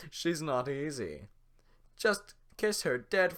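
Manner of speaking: loud, upbeat presenter voice; talk show host. Acted. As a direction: pitch her like a dating host. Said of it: "sounds like a talk show host".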